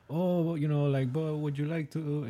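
lightly mock a Middle Eastern or Indian call-center voice; Middle Eastern or Indian accent. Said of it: "Middle Eastern guy or a Indian dude".

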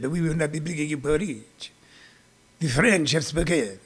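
low, soft put-on French accent; hushed, lofty French refusal; mock French. Comic as the French refusing porridge deliveries, mock French accent.

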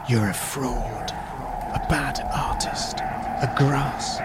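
slow eerie whisper; gusting windy whisper. Acted. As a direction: breathe it out slowly like the wind mocking him. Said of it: "whispered the wind".

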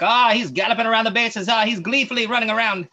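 put-on high, loud, gushing old-time newsreel announcer; old highlight announcer. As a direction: ham it up like a vintage sportscaster. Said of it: "They're just like".

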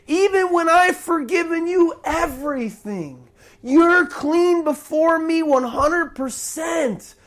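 high, impassioned acted scolding; indignant reproach. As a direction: demand an answer as the betrayed master.

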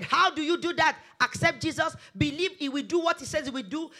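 ordinary delivery

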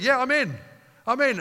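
preacher voicing listeners eagerly signing up; mock eager agreement. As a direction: jump in like an eager volunteer. Crowd voiced eagerly joining.

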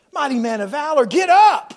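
rising into a loud, high shout; angel's command. Acted. Angel rousing hiding Gideon, commanding shout. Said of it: "an angel came and said".

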